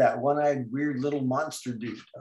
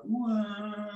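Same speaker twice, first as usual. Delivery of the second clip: short high cartoon "wah" cry; mock cartoon wail; 8 semitones higher, 5 dB quieter than usual. Shown as a demonstration. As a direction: fake a baby wail for the drawing. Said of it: "Notice I really do make sounds".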